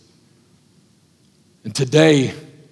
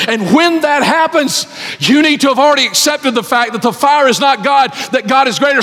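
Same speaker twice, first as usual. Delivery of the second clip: loud, high fervent preaching; 8 semitones higher, 3 dB louder than usual. Acted. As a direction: preach it out with full force. Preaching at full force.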